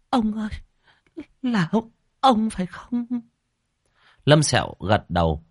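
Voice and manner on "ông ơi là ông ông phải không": very high, tearful pleading; tearful whimper. Acted. Woman recognises the old man's spirit, tearful. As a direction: call out on the verge of crying. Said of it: "bà tu bò lại mếu máo".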